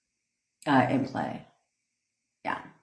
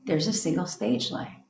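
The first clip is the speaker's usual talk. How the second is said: guie com voz suave e lenta, meditação calma; guided meditation; 4 semitones higher than usual. Acted. Leads a mock meditation.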